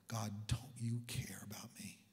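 hushed, half-whispered question to God; quiet plea. Retold or imitated. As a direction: plead softly, as if only felt. Felt, unspoken plea to God.

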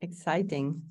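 usual speaking voice

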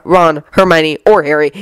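usual speaking voice

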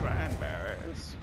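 very slow, drawn-out; old doctor voice. Retold or imitated. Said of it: "the old doctor from Operation".

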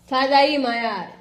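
loud sharp call to the cat. Real, not acted. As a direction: shout the cat off the plants. Scolding cat on the shelf.

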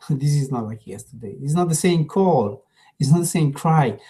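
usual speaking voice

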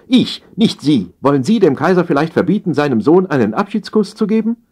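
high-pitched, affronted challenge; indignant empress. A narrator's reading. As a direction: rise in indignation toward an outraged question. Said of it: "fuhr die Kaiserin dazwischen".

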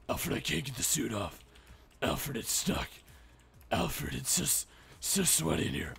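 low muffled hero in suit; Batman voice. Pretend. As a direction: whine like stuck Batman.

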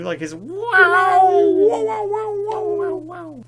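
high, slow robot wow-wows; R2-D2 warble. Retold or imitated. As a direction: warble high like a little droid. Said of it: "like an R two D two".

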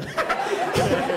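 high breathless laugh bursting out; burst of laughter. Real, not acted. Laughing at the cheap Brazilian, a high breathless burst. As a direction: crack up hard.

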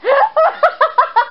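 high-pitched burst of laughter; amused laugh. Real, not acted. Player amused by Bowser and Mario's courting, high laugh.

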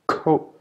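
"ko" high with a strong puff; K sound demo; aspirated K. Shown as a demonstration. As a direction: snap out "ko". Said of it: "K is pronounced ko".

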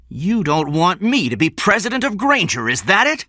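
loud, high, furious accusation; angry shout. A narrator's reading. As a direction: shout an enraged challenge at a rival. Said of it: "he shouted".

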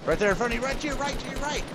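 high urgent shouted callout. Real, not acted. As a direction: yell the direction fast and high.

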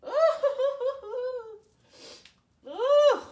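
squealing laugh at the taste; shrieking laugh as the drink hits; high squeal. Real, not acted. Reacting to the nasty taste.

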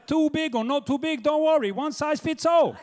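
Chinese Shakespeare. Acted. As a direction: play the Bard as a high-voiced Chinese seller. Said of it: "Why can't there be a Chinese Shakespeare".

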